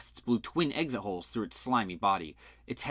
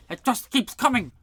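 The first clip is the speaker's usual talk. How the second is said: panicked shout; 13 semitones higher, 7 dB louder than usual. A narrator's reading. Monster attack, panic.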